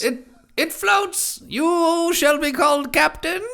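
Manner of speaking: high, slow mock-royal proclamation; herald. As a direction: join in as a pompous herald. Friend joins herald bit.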